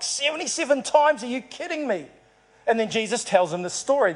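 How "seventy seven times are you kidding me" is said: Seventy-seven times? pitched higher in mock shock; mock disbelief. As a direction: play Peter's stunned disbelief, higher. Peter's brain blown, disbelief.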